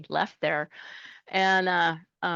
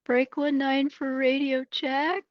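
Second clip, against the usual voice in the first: higher, slow, put-on trucker radio voice; CB radio call; 9 semitones higher, slower than usual. New driver calls out on the CB, slow put-on trucker voice. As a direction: announce yourself over the CB like a pro. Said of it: "I'd be like".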